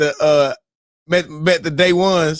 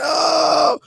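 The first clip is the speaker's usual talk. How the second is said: hype whoop; 10 semitones higher than usual. Retold or imitated. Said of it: "I said".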